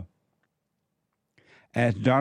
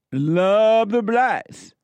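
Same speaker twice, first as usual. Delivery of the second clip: high, very slow, louder sneering drawl; mock Trump; 8 semitones higher, 5 dB louder, much slower than usual. Host mocking Trump's words, high slow sneer. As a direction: stretch "Love" out high and mocking. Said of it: "Here's Trump".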